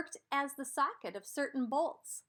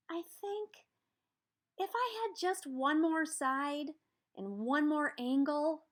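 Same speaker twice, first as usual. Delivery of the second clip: higher, small quadrilateral character voice; 5 semitones higher than usual. Acted. Quadrilateral asks the shapeshifter for one more side, higher voice. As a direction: ask hopefully in a higher little voice.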